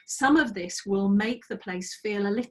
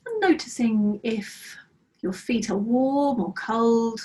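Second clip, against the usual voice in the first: calm, unhurried meditation guide voice; guided meditation. Acted. Teacher guiding students through a breathing practice, calm meditation voice. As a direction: lead the students gently into body awareness.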